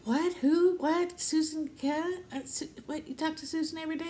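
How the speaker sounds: high, incredulous imitation of husband; mock confused husband; retold bafflement. Re-enacts husband's baffled reaction.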